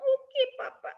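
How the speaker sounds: put-on high, whimpering child voice; a small cub near tears; weepy high "Okay, Papa"; tearful little cub. Cub about to cry.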